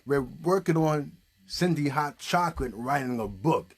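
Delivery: ordinary talk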